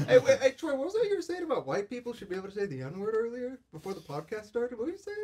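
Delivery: high, loud, laughing tease; laughing mock accusation. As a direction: call him out while cracking up.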